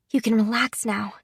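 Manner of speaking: usual speaking voice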